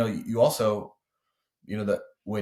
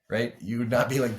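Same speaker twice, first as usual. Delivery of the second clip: normal talking voice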